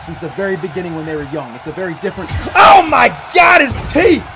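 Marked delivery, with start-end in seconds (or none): 2.6-4.2 loud, high horrified cry; shocked shout. Real, not acted. Fan seeing a wrestler's teeth hit, shocked yell. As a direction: react to a gruesome hit.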